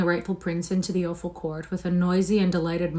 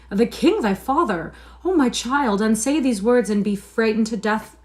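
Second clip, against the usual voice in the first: higher, louder anguished plea; distraught mother; 4 semitones higher, 6 dB louder than usual. A narrator's reading. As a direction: cry out in anguish to the child.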